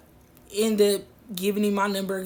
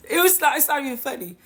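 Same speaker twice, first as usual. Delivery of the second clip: laughing denial; 4 semitones higher, 6 dB louder than usual. Real, not acted. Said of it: "I don't know why I just laugh like that".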